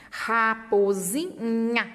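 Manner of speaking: Raposinha drawn out slowly, syllable by syllable; teacher reading the word slowly to a child. Shown as a demonstration. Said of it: "intervenções".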